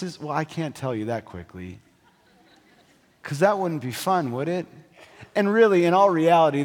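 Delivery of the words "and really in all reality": loud and high with excitement; excited. Real, not acted. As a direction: build up the big news. Excited build to reveal.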